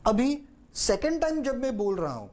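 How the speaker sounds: usual speaking voice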